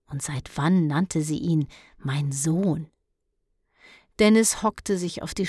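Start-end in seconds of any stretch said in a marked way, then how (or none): 2.0-2.9 whispered, incredulous echo of mein Sohn; bewildered boy mimicking his mother; inner whisper. Dennis echoes her words inwardly. (ungläubiges Flüstern)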